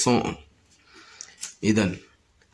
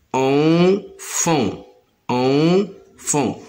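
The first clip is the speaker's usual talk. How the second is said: enfant held, higher, both nasal en sounds clear; sounding out; 3 semitones higher, much slower than usual. Shown as a demonstration. Teacher showing pupils the en in enfant. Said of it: "contient le son en deux fois".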